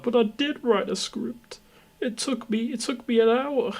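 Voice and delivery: high squeaky pleading voice; mock falsetto protest; whiny mock reply. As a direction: squeak it out like a wounded kid. Mocking own whiny reply.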